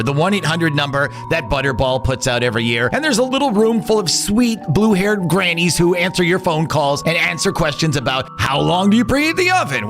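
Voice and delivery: usual speaking voice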